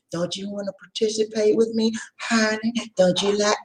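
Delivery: high, soft coaxing voice; mock seduction. Lady invites a man to join in, imitated seductive coaxing. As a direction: coax like an online temptress. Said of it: "And they say".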